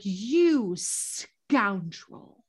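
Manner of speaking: slow, scornful retort; mock indignant. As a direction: drag it out with disdain. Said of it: "Griswold was like".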